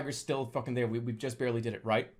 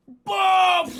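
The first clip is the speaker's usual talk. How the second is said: loud, high exploding "boom"; imitated explosion; 11 dB louder than usual. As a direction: burst out with a big blast noise. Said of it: "and I just hear".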